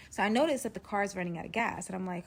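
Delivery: ordinary delivery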